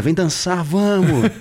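high, playful tempter voice; devil's enticement. Retold or imitated. Devil in his ear urging him to give in, high and teasing. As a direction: impersonate a devil luring someone to dance. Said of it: "o capeta começou a tocar flauta".

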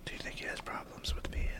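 very soft, voiceless whisper; conspiratorial whisper. Pretend. Friends gossiping while he pees, whispering. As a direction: whisper it behind his back. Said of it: "Let's just whisper about him".

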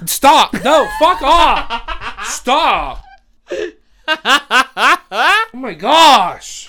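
high, loud shouts through laughter; laughing protest. Real, not acted. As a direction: yell for them to stop, cracking up. Begging co-host to stop the noises.

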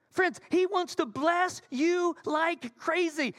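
high, slow, emphatic preaching; exuberant proclamation. Real, not acted. Same words repeated emphatically.

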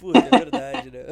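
loud high laughing admission. Real, not acted. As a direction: laugh hard through it. Realising the joke, laughing.

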